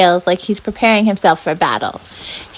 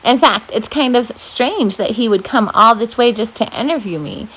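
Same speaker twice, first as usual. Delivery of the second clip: plain reading voice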